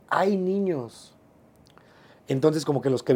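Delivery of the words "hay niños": grito alargado y agudo, sin volumen; grito imitado. Retold or imitated. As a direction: imita a alguien avisando a gritos, alargando las vocales. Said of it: "alguien empieza a gritar". (grito contenido de aviso)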